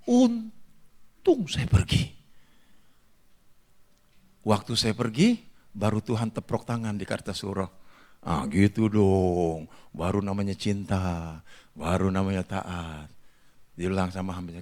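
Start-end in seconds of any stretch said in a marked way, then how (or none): 0.1-2.1 high, very slow exclamation; drawn-out relief. Retold or imitated. Repeats the relieved cry from story. 8.2-13.1 low, measured voice of satisfaction; approving God. Retold or imitated. Voices God approving of his obedience.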